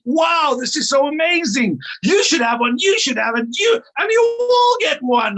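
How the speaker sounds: overexcited fan, voice way up; hammed-up delight, like Oprah giving gifts; gushing excitement. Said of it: "everybody's gonna go like me".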